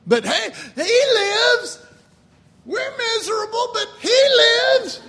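high, loud hammed-up cheering; mock celebration. Preacher parodying miserable churchgoers celebrating, mock-jubilant shout. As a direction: cheer it as if misery didn't matter.

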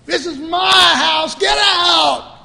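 loud, high furious shout; angry shout. Acted. Jesus driving the junk from his house, shouting in fury. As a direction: roar it like driving traders out.

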